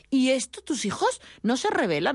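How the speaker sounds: a kid quizzing mom, lightly voiced; curious child asking. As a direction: ask it like a puzzled kid. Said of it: "me preguntaban".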